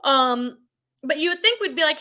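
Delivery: normal talking voice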